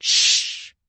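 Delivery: sharp loud unvoiced shush. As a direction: hush them with a loud shh. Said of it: "the Hatter and the March Hare went".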